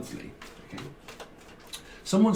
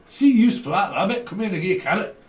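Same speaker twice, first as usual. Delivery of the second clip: put-on Scottish accent; 5 semitones higher, 6 dB louder than usual. Voicing someone from Scotland.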